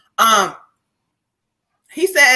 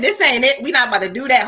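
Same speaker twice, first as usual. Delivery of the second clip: ordinary talk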